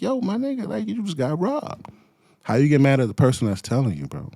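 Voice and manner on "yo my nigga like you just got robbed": high, exasperated pleading. Acted. Telling a robbed friend the obvious, high and exasperated. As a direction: try to make him see sense.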